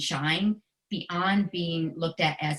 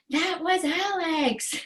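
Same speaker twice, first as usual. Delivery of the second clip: high, slow proud shout of his name; proud call; 10 semitones higher, slower than usual. Retold or imitated. Mom on the sideline proudly calling out her son's name.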